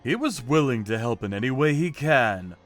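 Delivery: plain reading voice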